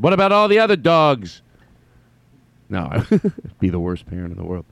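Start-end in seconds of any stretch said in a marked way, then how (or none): 0.0-1.4 put-on high-pitched, louder kid's protest. Imagined kid questioning a parent about the dogs, high protest. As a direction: plead it like an upset kid, pitch way up. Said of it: "would have said if I had a kid".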